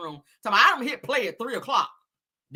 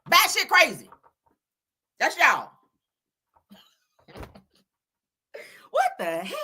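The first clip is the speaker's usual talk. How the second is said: ordinary talk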